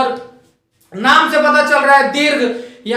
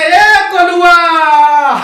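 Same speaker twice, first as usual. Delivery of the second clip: very slow, higher, louder call across a field; calling out far; 4 semitones higher, 6 dB louder, much slower than usual. Retold or imitated. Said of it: "दूर होते हैं खेत में तो बुलाते हैं". (खेत में दूर से ज़ोर से पुकारना)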